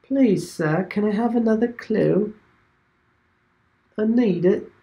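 high, pleading put-on English accent; mock English beggar. To teammates, begging for a clue in a mock English accent. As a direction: beg for a clue like an English orphan. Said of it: "you have to do an American accent".

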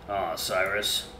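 soft, slow and tender; tender sympathy. Acted. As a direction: gently comfort a tearful friend. Friend comforts emotional Cyrus.

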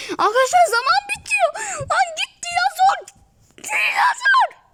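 very high, loud screaming that builds to a cry; panicked shouting. Real, not acted. Gamer screaming at the dinosaur as time runs out.

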